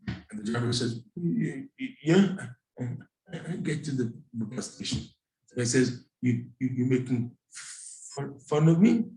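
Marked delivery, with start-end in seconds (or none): 1.2-5.0 driver stammering through his answer; imitated stutter. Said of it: "we talk like, like this". 6.2-9.1 stuttered, offended question rising at the end; imitated stutter. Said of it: "we talk like, like this".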